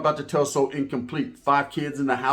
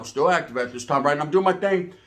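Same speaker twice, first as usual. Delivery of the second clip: usual speaking voice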